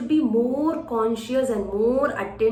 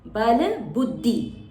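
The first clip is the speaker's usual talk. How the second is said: "Bal buddhi" very slowly, dh pressed; slow demonstration; much slower than usual. Shown as a demonstration. Teacher repeating the phrase for the class. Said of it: "Again buddhi".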